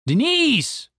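name called high, then falling; alarmed cry. Acted. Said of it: "cried Randy".

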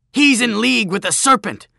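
high, loud accusing scream; panicked scream. Acted. Bully denouncing Joshua over a snake, high scream. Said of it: "Jachin screamed".

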